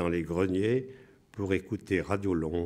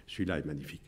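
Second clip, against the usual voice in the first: ordinary talk, nothing marked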